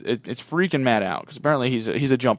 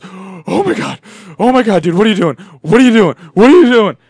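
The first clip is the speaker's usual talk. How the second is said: high, loud, escalating panic; panicked freak-out; 6 semitones higher, 10 dB louder than usual. Acted. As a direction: get more frantic with each question. Panicked friend acted in story.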